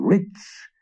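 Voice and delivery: rich shot up high in greedy delight; greedy wizard's high, thrilled rich; gleeful cry of rich. A narrator's reading. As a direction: squeal the word rich with glee. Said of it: "the wizard gloated".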